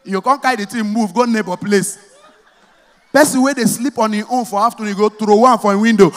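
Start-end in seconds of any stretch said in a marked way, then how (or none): none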